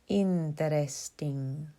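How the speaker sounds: drawn-out syllables in a put-on Spanish accent; string the word out slowly, Spanish-style; mock Spanish accent. A narrator's reading. Young man teases a reader of Borges, mock Spanish accent. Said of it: "He strung out the syllables in a mock Spanish accent".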